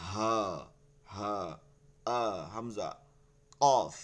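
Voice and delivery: each letter said heavily; heavy articulation. Shown as a demonstration. Teacher demonstrating the heavy letters to students, letters pronounced heavily. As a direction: separate Arabic letters, each given weight. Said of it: "কোনোটা কি ভারীভাবে".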